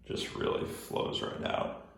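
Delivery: slow whispered aside; whispering. Real, not acted. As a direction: breathe it out as a whisper.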